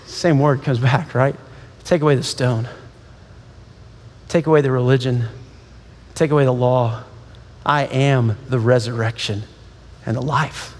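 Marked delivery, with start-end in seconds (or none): 1.8-10.7 low, measured voice with long pauses; hushed solemnity. Acted. Preacher giving Jesus' words on law and resurrection, low and solemn. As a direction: let it settle, low and grave.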